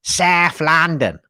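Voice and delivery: slow, drawn-out South London accent; London accent performed for a listener. Shown as a demonstration. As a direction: put on a thick South London voice. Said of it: "So just for Gary, here we go".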